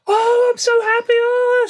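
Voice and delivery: imitating his wife squealing with joy; husband imitating his wife's delighted squeal. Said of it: "So she was delighted".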